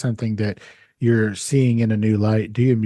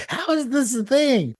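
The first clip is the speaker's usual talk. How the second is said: retelling his confusion at the strange word, pitched higher in disbelief; baffled disbelief; 12 semitones higher than usual. Retold or imitated. Host retelling his confusion at his wife's word, disbelieving. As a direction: pitch jumps much higher in mock disbelief. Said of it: "And I was just like".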